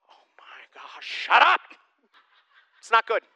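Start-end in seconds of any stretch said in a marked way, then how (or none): 0.1-1.6 exasperated snap. Retold or imitated. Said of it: "if you go".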